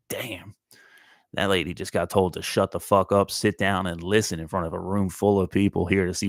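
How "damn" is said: awed hushed whisper; softer impressed whisper; whispered damn. Retold or imitated. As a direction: breathe it out, impressed. Reacting to lady getting told off.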